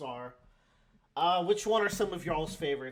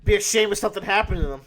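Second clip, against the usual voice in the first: loud, fast mock mafia threat; smiling threat; 4 semitones higher, 9 dB louder, much faster than usual. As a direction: menace them playfully, quick and loud. Mock threat about his crew.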